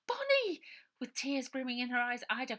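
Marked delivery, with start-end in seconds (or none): 0.1-0.6 high, loud cry of her name; relieved cry. A narrator's reading. Ida finding her little sister, high loud cry. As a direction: call out to the lost sister.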